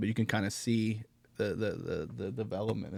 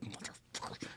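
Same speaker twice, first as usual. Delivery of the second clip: angry mutter; 16 dB quieter than usual. Retold or imitated. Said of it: "I'm, like, angry calling him".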